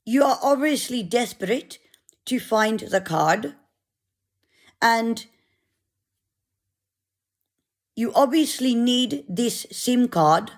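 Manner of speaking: a mild attempt at a South African accent; half-hearted South African accent impression; lightly mimicked South African volunteer. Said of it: "I'll try and do the accent".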